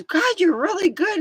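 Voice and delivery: high, bright mock-dad voice; dad's praise. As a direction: squeak out dad's compliment. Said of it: "he goes".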